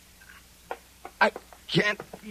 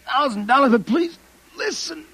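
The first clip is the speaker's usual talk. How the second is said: desperate begging; 7 semitones higher than usual. Acted. Desperate man begs the officer to listen, rising frantic plea. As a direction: plead as if it's your last chance.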